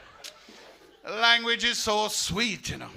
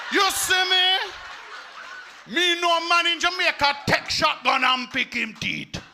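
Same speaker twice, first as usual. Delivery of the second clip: loud, high Jamaican patois tirade, half laughing; Jamaican patois rant; 6 semitones higher, 4 dB louder than usual. Retold or imitated. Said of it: "I kicked off against this redneck".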